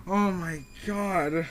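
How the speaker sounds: slow, loud, high gasp of horror; real dread breaking through; loud stunned oh my god; shocked. Real, not acted. Discovers the shocking scene.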